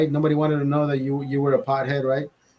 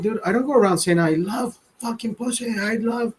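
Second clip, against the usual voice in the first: 1 mock reply; 6 semitones higher than usual. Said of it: "I'm like".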